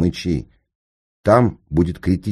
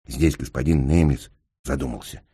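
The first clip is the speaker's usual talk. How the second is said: ordinary delivery, nothing marked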